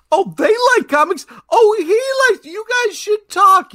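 high, loud excited matchmaker voice; Liwana impression. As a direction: squeal it like an eager matchmaker. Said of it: "a flawless Liwana impression".

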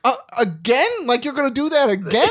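high-pitched mock disbelief, edged with laughter; incredulous complaint. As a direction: imitate an exasperated wife questioning him. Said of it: "the second time she's like".

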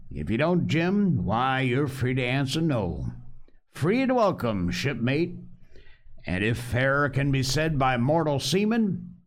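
Long John Silver. Acted. Pirate cook offers the boy a choice, coaxing. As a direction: sea cook laying down terms with a grin.

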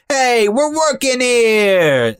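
slow, high New York accent, hammed up. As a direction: mimic a sudden thick New York accent. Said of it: "you'll hear him be like".

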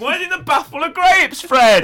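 high, loud cry through laughter; laughing disbelief. Real, not acted. Laughing at Fred sitting in a bath of grapes. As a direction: shriek the question between laughs.

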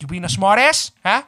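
loud, challenging New York demand; pretend-angry New York confrontation; angry New York accent. Speaker challenging a smart ass, mock-angry New York accent. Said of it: "go into that New York accent".

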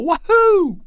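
high, loud celebratory whoop; exuberant yell. Pretend. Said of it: "eat a Skittle and yell".

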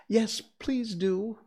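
high, slow chorus of voices begging; Alice's companions plead together in chorus, high and drawn out; chorus of pleas. A narrator's reading. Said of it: "all the others joined in chorus".

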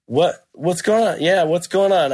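retold puzzled reply. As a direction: act out being caught off guard. Own puzzled reply to the confrontation.

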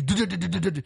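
rapid-fire bumping sound; imitated slamming noise. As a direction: stutter out the jolts of the truck bed. Imitating the truck slamming them.

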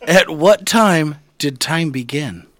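slow, portentous question; mock-solemn host question; slow grand question. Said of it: "I wanna ask you guys these questions".

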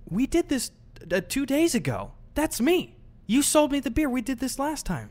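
high, insistent incredulous reminder; exasperated reminder. Retold or imitated. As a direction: remind her, baffled and pushing. Retold exasperated reminder to cashier.

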